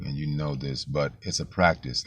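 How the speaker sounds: ordinary talk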